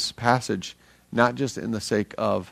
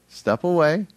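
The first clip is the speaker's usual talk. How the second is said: high, louder playful command: step away; urgent call; 7 semitones higher, 5 dB louder than usual. Retold or imitated. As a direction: call it out with a grin. Repeats God's call again.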